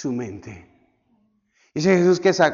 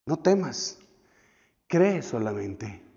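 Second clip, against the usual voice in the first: hushed and gentle, sinking lower and softer to the end; soft reassurance; 5 semitones lower, 7 dB quieter, slower than usual. Acted. Jesus gently reassuring the grieving father, hushed. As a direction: ease his fear quietly.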